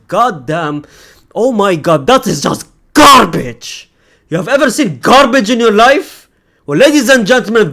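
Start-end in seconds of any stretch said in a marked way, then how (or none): 1.4-6.3 disgusted rant. Real, not acted. Fan ranting at rival lineup, disgusted and loud. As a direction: spit out the word garbage.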